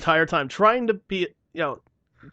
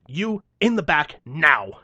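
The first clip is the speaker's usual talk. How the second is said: angry command; 2 semitones higher, 8 dB louder, slower than usual. Retold or imitated. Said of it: "part of me wanted to go".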